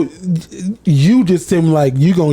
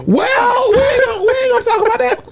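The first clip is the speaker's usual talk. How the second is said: high-pitched protest; 14 semitones higher than usual. Acted. Co-host brushing off the boat accusation, high-pitched protest. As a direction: squeal a deflection way up high.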